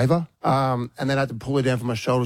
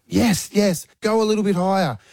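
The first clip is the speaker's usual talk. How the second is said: proud dad cheering; 8 semitones higher than usual. Retold or imitated. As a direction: cheer your daughter on, bursting with pride. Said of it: "I was a proud dad".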